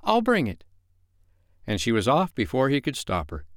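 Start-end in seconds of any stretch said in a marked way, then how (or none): 0.0-0.6 put-on very high, eager little girl; eager to help. A narrator's reading. Wendy offering to fetch the medicine, eagerly. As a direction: chirp it, keen to be useful. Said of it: "always glad to be of service".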